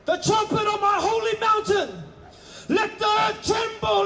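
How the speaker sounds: ordinary talk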